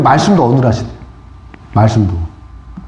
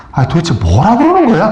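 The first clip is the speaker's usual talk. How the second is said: annoyed mutter to himself. Retold or imitated. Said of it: "혼잣말로".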